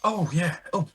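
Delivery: high put-on voice; weird high voice. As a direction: pitch it up, oddly posh. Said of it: "Stop talking weird".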